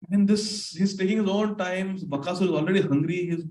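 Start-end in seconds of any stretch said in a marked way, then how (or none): none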